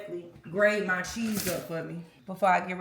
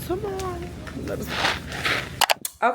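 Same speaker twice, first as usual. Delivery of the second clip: mock coaxing; 11 semitones higher, much slower than usual. Shown as a demonstration. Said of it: "he told me I need to stop cursing".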